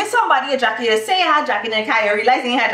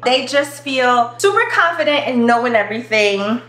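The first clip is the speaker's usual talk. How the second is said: ordinary talk, nothing marked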